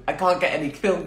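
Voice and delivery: ordinary talk, nothing marked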